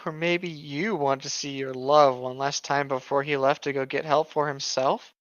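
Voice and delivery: usual speaking voice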